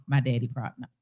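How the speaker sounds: usual speaking voice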